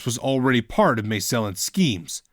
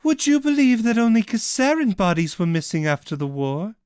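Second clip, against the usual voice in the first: teasing; 9 semitones higher than usual. Acted. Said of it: "Cassian teased".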